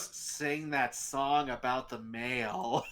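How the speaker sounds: low, slow, soft request; slow sultry request. Retold or imitated. Date asking Steve to sing, low and slow. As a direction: drawl it out, very slowly. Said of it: "And she goes".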